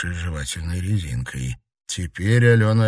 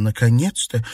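glad, higher-pitched cry of relief; joyful exclamation; 5 semitones higher, 4 dB louder than usual. A narrator's reading. Marina greeting long-awaited friends, joyful. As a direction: exclaim it with delight at last. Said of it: "радостно воскликнула она".

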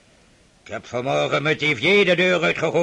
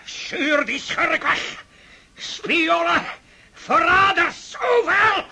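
higher, shouted words of outrage; angry rant; 10 semitones higher than usual. Acted. Old prince raging at his daughter about spies and traitors, angry shouting. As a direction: rail at traitors everywhere.

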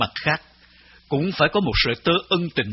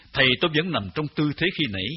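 ordinary delivery

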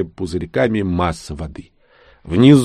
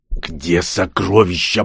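growl; 2 semitones lower, slower than usual. Acted. Dark grips the man's throat demanding treasure, slow menacing growl. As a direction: squeeze the words out like a killer at the throat. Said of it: "прорычал Дарк".

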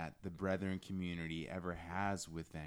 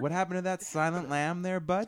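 loud, higher teasing jab; teasing call-out; 10 semitones higher, 10 dB louder than usual. Real, not acted. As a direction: needle him loudly. Teasing challenge.

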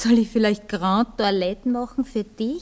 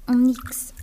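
whispered. Acted. Anni dismissively whispering to Anatol, hushed. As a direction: drop it to a whisper.

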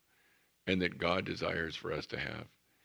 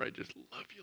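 whispered prayer; 13 semitones higher, 9 dB quieter, faster than usual. Shown as a demonstration. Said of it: "whispered high line in prayer".

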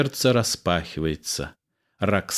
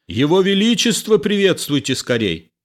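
high, urgent command; shouting to soldiers; 10 semitones higher, 3 dB louder than usual. A narrator's reading. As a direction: cry out an order to the troops. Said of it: "Кричит солдатам".

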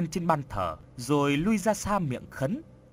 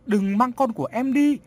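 high, loud tearful cry; wife wailing over her dying son; bursting into tears; 9 semitones higher, 5 dB louder than usual. Acted. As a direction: beg the spirit not to take her child. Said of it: "Vợ anh Bình khóc òa lên".

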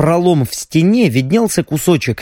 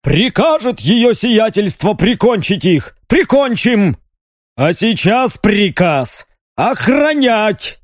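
stern shouting; 6 semitones higher than usual. A narrator's reading. Second guard keeps scolding.

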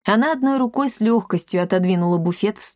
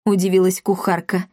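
plain reading voice

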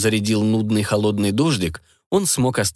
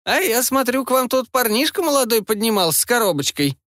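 put-on nosy old granny; 12 semitones higher than usual. Granny presses on.

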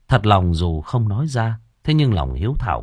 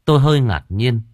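usual speaking voice

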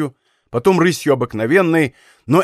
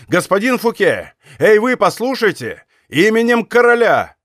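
higher, commanding hail; raised call, building to an order; 5 semitones higher, 3 dB louder than usual. A narrator's reading. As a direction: hail a fleeing rider, building louder. Captain calls out to fleeing rider.

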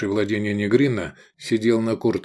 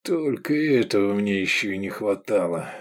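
durch die Zähne. A narrator's reading. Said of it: "процедил Лобанов".

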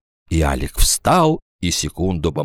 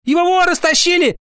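very high schoolboy outburst; excited cry; faster than usual. A narrator's reading. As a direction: blurt it out before standing up. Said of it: "закричал Витя Синицын". (взволнованный крик мальчишки)

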